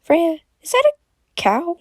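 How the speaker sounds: high, hesitant timid question; timid little high voice; scared and shy. A narrator's reading. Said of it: "Scared and shy".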